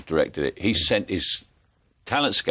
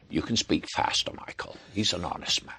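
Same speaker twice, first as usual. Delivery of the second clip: John Huston impression; 7 dB quieter than usual. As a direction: murmur it like old Huston. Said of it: "He said".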